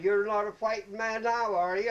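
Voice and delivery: mocking colonel yell. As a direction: taunt the soldier at full voice. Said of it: "yelling at everybody".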